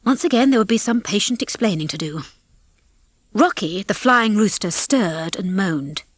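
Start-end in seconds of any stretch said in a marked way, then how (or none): none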